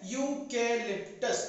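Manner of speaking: Eucalyptus slowly, in parts: yu-ke-lip-tus; slow model pronunciation. Shown as a demonstration. Teacher answers her own question for children, slow syllabled eucalyptus. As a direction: stretch out eucalyptus so learners can follow. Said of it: "क्या पढ़ते हैं?".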